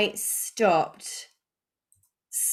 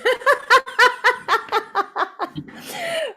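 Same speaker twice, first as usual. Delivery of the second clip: big high laugh; 6 dB louder than usual. Real, not acted. Laughing at Holly Ann.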